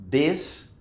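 "This" starting on a hard D, "dis". Shown as a demonstration. Teacher giving wrong examples on purpose, "This" pronounced with D for TH. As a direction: say "This" with a D, tongue behind the teeth. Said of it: "con una D".

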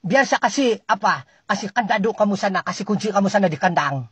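normal talking voice

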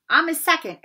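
defiant. Acted. Ron stepping up to Malfoy.

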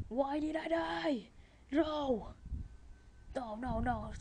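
loud, high wail of protest; frustrated shouting. Real, not acted. Gamer raging over dying in the game, frustrated shouting. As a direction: yell in real dismay at an unfair loss. Rages at losing character and stuff.

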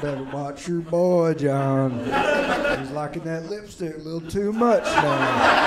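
a hint of a gruff uncle warning his brother. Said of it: "Uncle Joe would be like".

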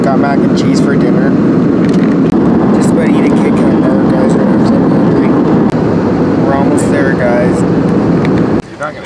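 speaking up over plane noise; loud cabin drone behind the voice; on an airplane. Real, not acted. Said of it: "If you guys can't hear me".

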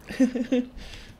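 a quick amused laugh; short laugh. Real, not acted. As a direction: laugh at yourself. Laughs at own plan changes.

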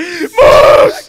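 very high, very loud call; panicked shout. Real, not acted. Gamer calling to teammate in trouble, panicked shout. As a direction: scream the name in alarm.

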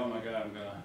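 a soft, sinking oh my God; quiet dismay. Retold or imitated. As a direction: let the fear of losing drop the voice down and soft. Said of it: "I go".

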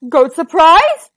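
troll perks up at the idea; troll surprised. A narrator's reading. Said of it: "cried the Troll".

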